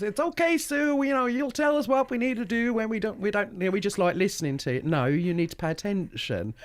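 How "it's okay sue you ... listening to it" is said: high, bright mock American tourists, carefree. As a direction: play the smiling tourist who isn't listening, pitched well up. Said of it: "And they're just".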